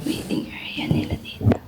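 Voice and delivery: whispered aside to the camera; under the breath in a waiting room; hushed whisper. Real, not acted. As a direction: keep it very soft, barely voiced. Murmuring in clinic waiting area.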